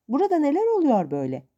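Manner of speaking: put-on old aunt shouting in surprise; old woman shouting. A narrator's reading. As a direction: bark it out as a shocked old lady. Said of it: "diye bağırdı".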